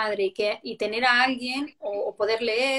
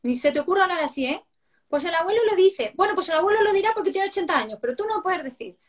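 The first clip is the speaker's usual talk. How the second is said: fast scolding that climbs higher; parent scolding; 7 semitones higher than usual. Retold or imitated. Parent scolding a child who copies grandpa, rising indignation. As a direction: tell the child off, building indignation.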